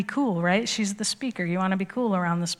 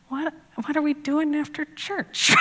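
higher, puzzled innocent husband asking; 7 semitones higher, 3 dB quieter than usual. Retold or imitated. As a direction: play him clueless, mildly higher. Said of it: "And he's just like".

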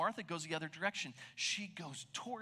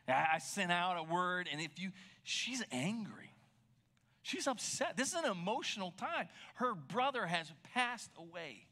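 ordinary delivery

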